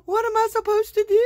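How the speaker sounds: high, loud, rushed panicky question; frantic, pitch up high and fast; in a panic, asking herself what to do; panicked. Retold or imitated. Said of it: "'cause you're in a panic and you're like".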